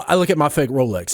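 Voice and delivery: usual speaking voice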